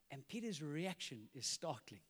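ordinary delivery

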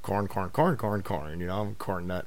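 low, soft retold reply; bashful mock mumble. Quoting his younger self among girls.

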